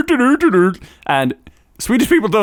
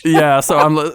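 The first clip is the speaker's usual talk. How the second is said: ordinary delivery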